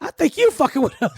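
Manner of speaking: laughing. Retold or imitated. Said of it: "I said".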